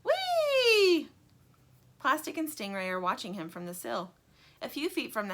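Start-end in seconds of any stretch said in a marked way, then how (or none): 0.0-1.1 high loud sliding squeal; gleeful whee. Acted. Lumpy zooming down the drift, gleeful whee. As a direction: squeal like a kid on a slide.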